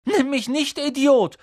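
high, indignant protest; indignant retort. Acted. Neville answering Ron's insult, high and indignant. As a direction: throw the insult back, loudly at the end. Neville quarrels back at Ron.

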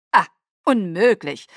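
contemptuous. Acted. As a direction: spit it out with disdain. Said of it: "sagte Kaius verächtlich".

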